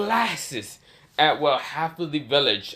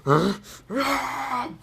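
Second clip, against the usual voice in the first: powering-up grunts; 10 semitones higher than usual. Acted. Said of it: "He's like".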